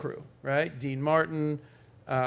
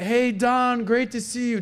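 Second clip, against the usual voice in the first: a higher, louder, showy hello; mock big greeting; 8 semitones higher, 4 dB louder, slower than usual. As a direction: greet him like the star of the room. Frank greets Don at dinner.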